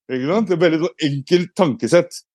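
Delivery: usual speaking voice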